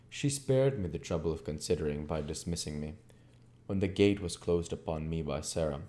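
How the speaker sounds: plain reading voice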